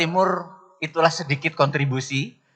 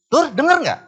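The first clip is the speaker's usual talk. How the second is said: high, loud impatient call; raised voice; 12 semitones higher, 5 dB louder than usual. Retold or imitated. Angry father to a son who won't fetch shoes, loud. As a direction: snap his name out, losing patience. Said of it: "naikin saya".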